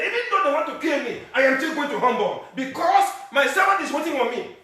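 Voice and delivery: high, loud, acted disciples and Jesus; preaching at full force. Pastor acting Jesus to the congregation, high and loud. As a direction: shout the story like you were there.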